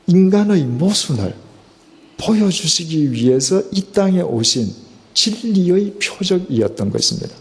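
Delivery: climbing pulpit intensity; fervent preaching. Acted. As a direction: preach it out with rising fervor. Preaching climax.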